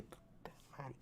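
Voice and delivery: barely audible dismayed swearing; retold curse under the breath; whispered curse. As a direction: whisper it to yourself, voiceless. Retells his dismayed inner reaction.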